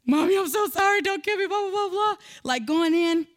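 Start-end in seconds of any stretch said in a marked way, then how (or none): 0.0-2.1 mock boo-hooing. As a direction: ham up a scared kid begging forgiveness. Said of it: "I'm boo-hooing".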